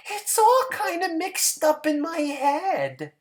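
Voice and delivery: high, small voice; simple Hazel. A narrator's reading. Hazel answering George, high and small.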